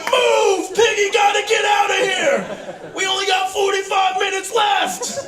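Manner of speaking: high, loud, laughing panic shout; frantic mock yell. Diabetic comic bursting into the DMV, frantic shout. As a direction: shove through the crowd in a rush. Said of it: "I'm like".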